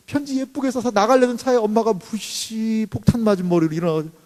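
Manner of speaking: ordinary delivery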